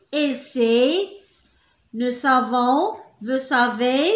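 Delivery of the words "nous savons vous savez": ordinary talk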